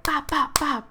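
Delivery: imitated punches. Storyteller recounting blows to the face, imitated punch noises. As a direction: rattle off the hits as sharp noises.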